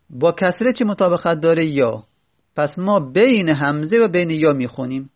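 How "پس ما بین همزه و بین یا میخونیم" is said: usual speaking voice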